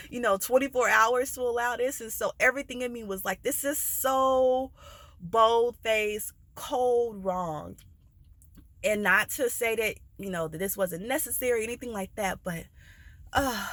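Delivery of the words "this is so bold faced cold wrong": "so" stretched long and raised; emphatic outrage. Retold or imitated. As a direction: hammer "bold-faced, cold wrong" in separate chunks. Voicing her inner outrage.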